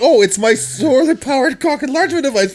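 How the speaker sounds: hammed-up Swiss-accented punchline; thick Swiss accent. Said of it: "such a thick Swiss accent".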